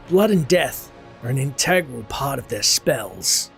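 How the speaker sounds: ordinary talk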